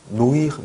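노이르 read letter by letter as "no-i-r"; wrong spelling pronunciation. Shown as a demonstration. Teacher warning learners off a mistake, 노이르 mispronounced "no-i-r". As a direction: say 노이르 with separate o and i. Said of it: "이런 거 아니에요".

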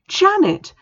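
high, loud cry of a name; desperate shout; horrified cry. Acted. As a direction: cry out to her in shock. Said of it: "cried Bethany".

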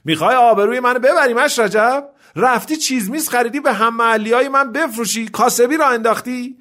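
high-pitched outraged telling-off; angry scolding. Acted. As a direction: scold him indignantly, pitched well up. Acted family quarrel over selling goods.

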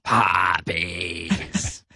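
deep, weary sighed word; mock sigh. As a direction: sigh it out, deflated. Mocking the poppies clip.

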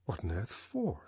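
soft, wondering inner voice; astonished inner thought. A narrator's reading. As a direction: think it quietly, puzzled. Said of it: "she wondered, astonished".

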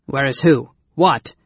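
loud, startled questioning; cried in alarm. A narrator's reading. Brother alarmed by waking mage's question, crying out. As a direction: burst out loudly, on guard. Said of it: "Caramon cried in alarm".

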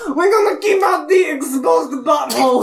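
hammed-up ranting character; mock furious rant. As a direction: shout like an unhinged foreigner ranting. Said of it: "Why do you sound like Filthy Frank".

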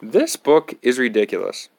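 ordinary delivery

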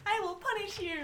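high, laughing heroine line; a lighter magical girl pose; bright magical girl. Acted. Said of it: "With the movements".

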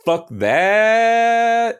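high, loud drawn-out devil scream; hammed-up devil's battle cry; defiant yell. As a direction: scream it out defiantly and hold the last word. Devil's defiant outburst in story.

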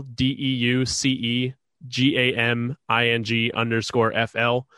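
ordinary talk, nothing marked

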